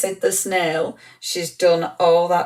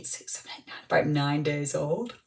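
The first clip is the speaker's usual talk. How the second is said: much softer, whispered tender aside; 2 semitones lower, 9 dB quieter than usual. Real, not acted. Tender news of newborn baby.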